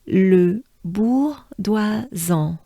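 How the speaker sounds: "Le bourgeoisan" drawn out slowly, throaty R; slow French pronunciation. Shown as a demonstration. Teacher saying the whole name slowly. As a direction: stretch "Le bourgeoisan" syllable by syllable. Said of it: "we take a good breath".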